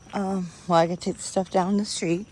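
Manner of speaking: usual speaking voice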